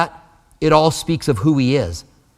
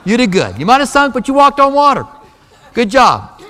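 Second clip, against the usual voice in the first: higher and louder, laughing with delight; gushing, joyful congratulations; enthusiastic praise; 5 semitones higher, 4 dB louder than usual. Pretend. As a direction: cheer him on like a proud coach. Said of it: "had Jesus gone".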